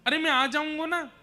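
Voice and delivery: high, loud pleading son; child consoling mother. Acted. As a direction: voice a boy earnestly reassuring his mother. Voiced child Krishna consoles weeping mother.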